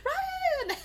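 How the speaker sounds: high, loud cry of his name with a laugh in it; tearful happy call. Retold or imitated. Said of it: "she's like".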